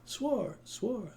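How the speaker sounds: high, fading echo of swore. Acted. The square echoing the praetor's oath, fading echo. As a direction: let the word ring off the square. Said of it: "It's like an echo through the square".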